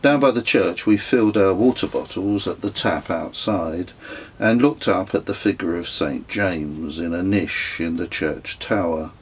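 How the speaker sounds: plain reading voice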